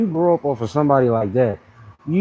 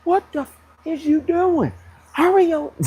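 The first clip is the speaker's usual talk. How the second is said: much higher, harsh coach bark from memory; yelling coach. Retold or imitated. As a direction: bark it like a tough football coach hurrying a player. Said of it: "I can just hear my coach's voice".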